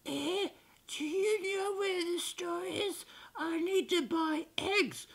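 high, soft, slow zombie voice. Acted. Zombie asks Carl the way to the store, high and slow. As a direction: play a feeble zombie, dragging the words out high. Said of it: "And then a zombie. And he's just, he's like".

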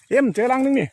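high and loud call to someone far off. Real, not acted. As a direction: shout across to the owner. Calling to the owner across the yard.

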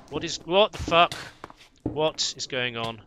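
loud, startled outburst; alarmed shout. Real, not acted. Player caught by a sudden attack, loud startled yell.

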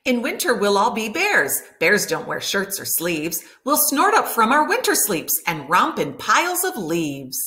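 plain reading voice

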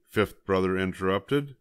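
usual speaking voice

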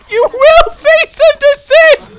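very high, loud, slow shriek; screamed command. Real, not acted. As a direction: scream the order, drawing out each word. Same order repeated, now shouted.

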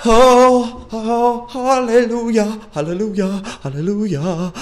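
high cries of hallelujah, easing off; exclaimed relief. Real, not acted. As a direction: start loud and high, then settle. Relief after tense moment.

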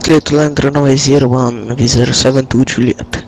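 loud, distorted radio readback; over a pilot headset. Real, not acted. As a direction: overdriven cockpit radio.

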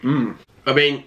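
chewing while talking; speaking between bites; mouth full. Real, not acted. Seen 4: Bite taken at -2s; cheeks full, chewing at word start.

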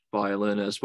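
ordinary delivery

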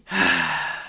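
long, low exhaled sigh; deflated sigh; heavy sigh. Real, not acted. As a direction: let out a real, weary sigh. Disheartened by the topic.

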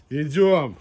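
loud call; calling a dog. Real, not acted.

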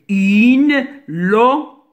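une locomotive broken into beats, lo, co, mo, tive; syllable by syllable. Shown as a demonstration. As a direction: separate each syllable of locomotive with a pause. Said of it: "Je répète".

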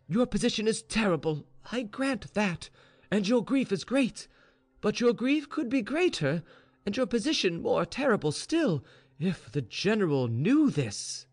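high-pitched pleading servant voice; earnest maid, urgent. Acted. Maid warns her grieving mistress, urgent and earnest. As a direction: press the warning home.

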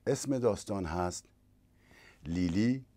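ordinary delivery, nothing marked

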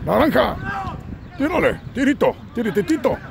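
high-pitched shoot calls; playful high tirititito cheer; excited urging. Acted. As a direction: yell at the striker to shoot. Urging shot live.

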